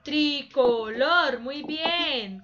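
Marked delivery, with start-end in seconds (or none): 0.0-1.4 "Tricolor" slowly in three held beats; slow three-beat demo of "Tricolor"; syllable by syllable. Shown as a demonstration. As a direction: separate tri, co, lor clearly. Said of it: "palabra tiene tres sílabas".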